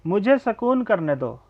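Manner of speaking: ordinary talk, nothing marked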